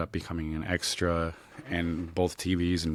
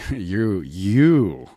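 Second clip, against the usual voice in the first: drawn-out, louder YOU with a laugh; mock protest; 3 semitones higher, 8 dB louder than usual. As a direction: lean hard on the second YOU, half laughing. Mock protest to prankster co-actor.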